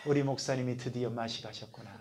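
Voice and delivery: soft, pitying aside about the pastor; pitying murmur. Retold or imitated. Congregation pities the pastor in a soft murmur. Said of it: "불쌍한 눈으로 쳐다보시겠죠".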